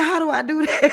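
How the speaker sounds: very high, whiny crying imitation; mock whine. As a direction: tease her panic with a fake whimper. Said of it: "She said".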